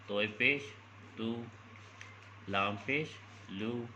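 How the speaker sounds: clear, measured classroom drill, one sound at a time; spelling drill. Shown as a demonstration. Teacher showing parents how to drill syllables, clear spelling-out. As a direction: spell each letter with its vowel mark, then say the syllable. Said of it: "پھر اس کو mix up کر کے پڑھانا ہے".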